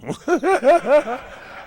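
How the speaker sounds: high, loud burst of laughter; real cackling laugh at a silly answer; big laugh. Real, not acted.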